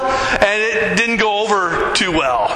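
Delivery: usual speaking voice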